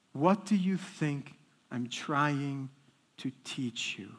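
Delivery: low, slow, soft reply from above; answering heavenly voice. Retold or imitated. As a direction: give a hushed, measured divine answer. Heavenly answer to his prayer.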